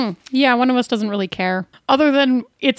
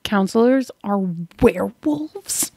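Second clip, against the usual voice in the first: hushed conspiratorial whisper. Acted. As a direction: whisper back the question. Secretive werewolf rumor.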